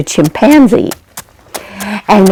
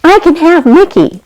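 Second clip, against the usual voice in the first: put-on higher, happy boy's announcement; excited boy; 9 semitones higher than usual. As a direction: burst out the good news. Timmy tells Roberto the news.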